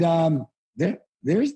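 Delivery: ordinary delivery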